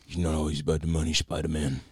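lightly voiced menacing crime boss; calm menacing drug lord. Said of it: "I loved the Kingpin voice".

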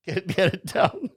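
slow, high, laughing suggestive repeat; laughing innuendo. Real, not acted. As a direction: chuckle through the double meaning.

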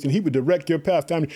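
usual speaking voice

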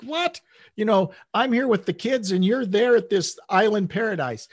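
high-pitched mock indignation; imitating mad wife. Wife to husband sending beach pictures from work trips, mock mad. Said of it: "my wife would get so mad at me".

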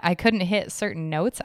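ordinary talk, nothing marked

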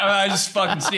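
ordinary delivery

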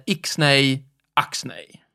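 "icksnej, axnej" said slowly in mock pig latin; pig latin demo. Shown as a demonstration. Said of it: "Och då blir icksnack".

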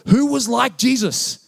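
preacher challenging the congregation; rhetorical question. Real, not acted. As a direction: lift it and land it hard. Rhetorical climax.

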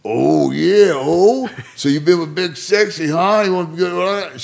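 high, loud wrestler impression; Randy. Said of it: "so Randy says to her".

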